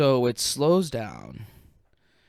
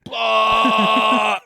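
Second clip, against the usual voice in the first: loud, higher retching roar; imitated projectile vomit; 7 semitones higher, 7 dB louder than usual. Shown as a demonstration. Storyteller shows how he vomited, loud imitated retch. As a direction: heave it out in one big burst. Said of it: "I literally went like this".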